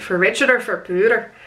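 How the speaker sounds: imitating a light Scottish accent. Bride imitates groom saying his vows, in a Scottish accent. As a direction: put on a hint of Scottish for the quote. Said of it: "He put on a Scottish accent when he said it".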